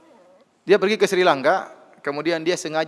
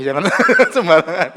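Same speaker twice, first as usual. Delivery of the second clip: laughing; 2 semitones higher, 9 dB louder than usual. Real, not acted. Story punchline, laughing through.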